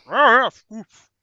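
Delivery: slow, loud growling gnome; loud slow grunting voice. Acted. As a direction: grunt it like a beast chewing. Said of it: "You just hear".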